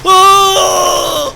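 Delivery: loud high scream of terror. Acted. Player fleeing the monster, loud scared scream. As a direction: shriek as the blade comes down. Monster about to slice him.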